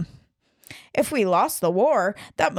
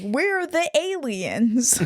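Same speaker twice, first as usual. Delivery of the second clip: slow, higher, stressed on We're; mock quote; 5 semitones higher, much slower than usual. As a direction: repeat We're the aliens slowly, mocking. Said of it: "not using the term alien properly".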